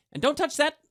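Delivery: sharp warning. A narrator's reading. Snapping at Gulick.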